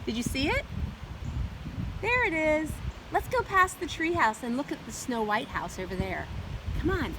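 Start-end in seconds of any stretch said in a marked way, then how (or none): none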